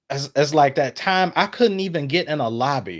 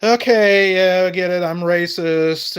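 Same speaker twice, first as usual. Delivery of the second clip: loud, higher sarcastic mock agreement; 6 semitones higher, 6 dB louder than usual. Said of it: "I'm like".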